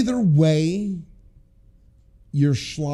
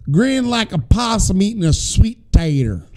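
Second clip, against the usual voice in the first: loud, hammed-up Southern accent; attempted Southern accent; 3 semitones higher, 5 dB louder than usual. New Yorker copying a caller's saying, exaggerated Southern accent. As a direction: try on a big Southern twang. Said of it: "Let me, let me try this".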